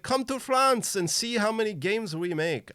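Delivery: mock a French exhibitor inviting you over; playful bad French accent; horrible French accent. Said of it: "that's my horrible French accent".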